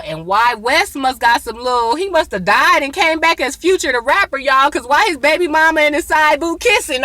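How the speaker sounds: high, loud, joking rant; excited. Real, not acted. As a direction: tease it up loudly. Joking about Future rapper.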